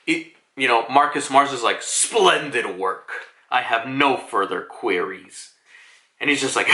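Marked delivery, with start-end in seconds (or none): 1.8-5.5 slow, lowered voice of an official; formal superior. Retold or imitated. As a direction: play a composed superior closing the matter. Voices Marcus Mars dismissing Lucci.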